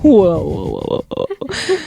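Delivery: a slow, rising whoa of shock; stunned whoa. Retold or imitated. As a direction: reel back in disbelief, slowly. Shocked reaction to ex's call.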